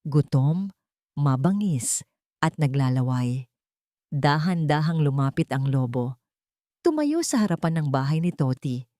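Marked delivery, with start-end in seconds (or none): none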